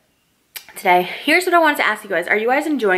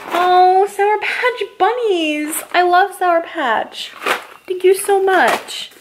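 squealing delight; 8 semitones higher, slower than usual. Real, not acted. Unwrapping favorite candies.

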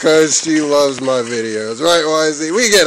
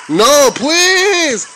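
high, loud hammed-up begging; cartoonish high-pitched plea; mock pleading; 14 semitones higher, 4 dB louder, slower than usual. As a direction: whine for mercy in a squeaky voice. Begging enemy in game.

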